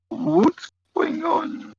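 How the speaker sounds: high, slow, bewildered squeak; confused squeal. Retold or imitated. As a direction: squeal it out, totally lost. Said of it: "and you're like".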